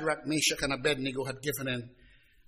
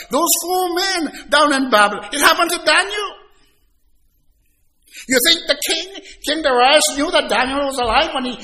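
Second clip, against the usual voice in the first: loud, higher, driving sermon climax; fervent pulpit preaching; full-force preaching; 10 semitones higher, 9 dB louder than usual. Acted. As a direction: preach it out to the congregation.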